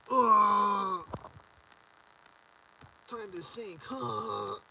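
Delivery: a hammed-up imitation of a snorter getting hyped; jeering impression of a sync powder user; mock sync snorter.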